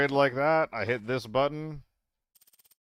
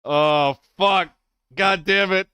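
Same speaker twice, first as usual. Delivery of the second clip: loud, higher frustrated swearing; frustrated cursing; 6 semitones higher, 7 dB louder, slower than usual. Real, not acted. Gamer cursing at another lost round, loud real frustration. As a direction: blurt the curses out in real anger.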